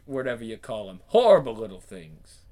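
usual speaking voice

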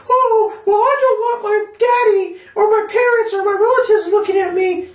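very high whiny falsetto; mocking women. Man mimicking women who refuse to be filmed, mocking falsetto. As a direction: mimic them in a squeaky, singsong falsetto. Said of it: "these women. They're always over here saying".

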